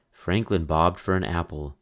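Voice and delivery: plain reading voice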